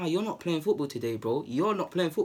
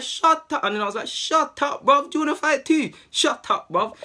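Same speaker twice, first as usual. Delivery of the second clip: high, loud shut up, bruv; angry shout; 10 semitones higher, 5 dB louder than usual. Retold or imitated. Player shouting down the mouthy centre half, angry yell. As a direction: shout him down hard.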